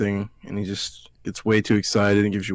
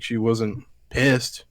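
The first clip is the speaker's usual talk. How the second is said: ordinary talk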